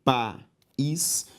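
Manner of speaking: "país" split as pa, ís; a clear pause inside "país"; syllable by syllable. Shown as a demonstration. Teacher separating the word for students, syllable by syllable. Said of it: "se eu separo lápis e país".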